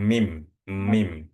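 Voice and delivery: "Mim, mim", each m pressed out between the lips; teacher demonstrating "mim" with both lips meeting; lips-closed mim. Shown as a demonstration. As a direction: lean on the closed-lip m of "mim". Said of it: "bibir bawah ketemu dengan bibir atas".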